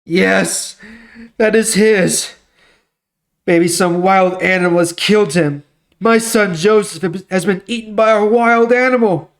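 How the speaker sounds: a father's grief rising with each line; grieving father. Acted. Jacob seeing his son's bloody coat, voice rising in grief.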